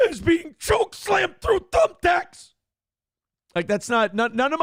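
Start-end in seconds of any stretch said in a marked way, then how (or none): 0.1-2.5 promo climax, very high and drawn out; Mankind. Acted. Comedian climaxes his mock promo to Rick, high wrestler impression. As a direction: burst upward into the slow, strained payoff of a promo. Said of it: "we could do a Mankind promo".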